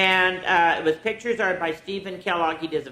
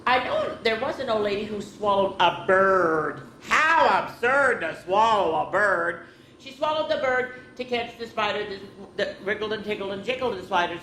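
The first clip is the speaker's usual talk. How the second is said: lively storybook read-aloud; reading to children; 4 semitones higher than usual. Acted. Teacher reading a picture book to children, lively. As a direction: read it out to the kids with bounce.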